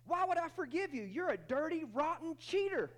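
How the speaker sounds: high, loud accusing wife; wife refusing forgiveness, high and loud; indignant wife. Acted. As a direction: hurl the insult back at him. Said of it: "she says to him".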